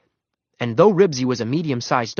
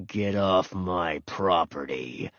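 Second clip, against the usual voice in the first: low, slow, soft menace; growling dog; 5 semitones lower, 6 dB quieter, much slower than usual. A narrator's reading. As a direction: order him off in a low dog's growl. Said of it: "Ranger's growl answered".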